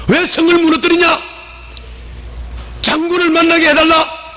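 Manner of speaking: high, forceful shouted challenge; shouting. Shown as a demonstration. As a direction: yell the demand up at the attackers. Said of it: "소리를 질렀단 말이지". (높은 소리로 외치는 고함)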